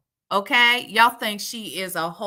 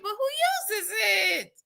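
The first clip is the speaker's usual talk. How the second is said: incredulous question; 12 semitones higher, slower than usual. Real, not acted.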